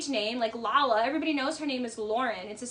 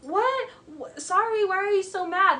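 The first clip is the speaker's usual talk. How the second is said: high-pitched, louder fake bewilderment; mock him playing the baffled innocent; put-on dumb act, pitched way up; feigned innocence; 6 semitones higher, 5 dB louder than usual. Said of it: "tries to flip it".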